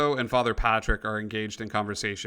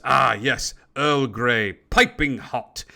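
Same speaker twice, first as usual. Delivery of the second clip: louder, relished genteel announcement; Mister Fancy Pants; 5 dB louder, slower than usual. Acted. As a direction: savour it slowly like a fancy tea host. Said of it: "and he goes".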